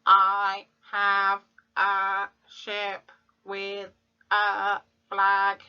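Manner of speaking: slow, even robot voice; slow monotone robot reading the sentence. Shown as a demonstration. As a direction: speak each word like a machine, slowly. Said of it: "let's say it in a robot voice".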